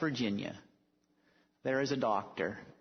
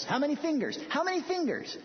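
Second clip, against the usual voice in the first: high, pushy questioning voice; insistent patient; 14 semitones higher, faster than usual. Retold or imitated. Mental patient quizzing strangers, high and insistent. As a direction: badger them with the question.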